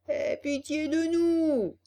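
slow, high wail; hammed-up whining entreaty; plaintive begging. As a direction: stretch it into a pitiful beggar's plea. Fox begs again.

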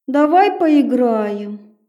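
put-on slow, eerie little girl; creepy child.

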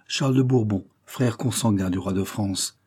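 ordinary delivery

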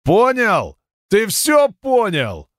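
high, slow gloating threat; menacing cyclops. Acted. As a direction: lean in and menace slowly. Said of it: "Голос циклопа".

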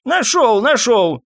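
put-on high, excited old voice; elderly man crying out in relief; triumphant old man. A narrator's reading. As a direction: call out the find in a high voice. Old man returns with the medicine.

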